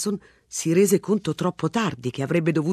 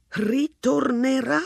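slow, scornful sarcastic question, higher; 8 semitones higher, slower than usual. A narrator's reading. Homeowner mocking the sergeant over the empty flat, sarcastic. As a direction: drag it out with disbelief. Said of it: "Esclamò mister Ranson con sarcasmo".

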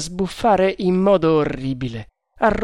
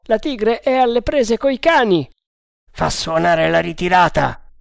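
combat callout; 6 semitones higher, 4 dB louder than usual. Acted. Grida d'allarme nella caccia.

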